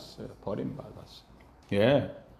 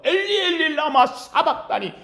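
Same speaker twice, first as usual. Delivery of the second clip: crying out; 8 semitones higher than usual. Retold or imitated. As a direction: shout the question up to God. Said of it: "하고 외치는 거예요".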